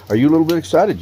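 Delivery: hammed-up eager question; mock excitement. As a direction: pump him up with fake glee. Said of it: "I'm getting excited".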